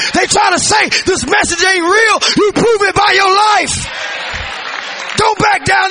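loud, high preaching with a shouted build; full-force preaching. Real, not acted. Preacher urging the church not to back down, shouting. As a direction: drive the congregation, don't let them back down.

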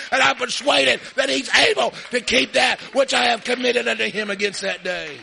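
loud, fired-up preacher declaration; preaching at full force. Acted. Preacher defying the devil before the congregation, loud and fired-up. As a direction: thunder it at the devil.

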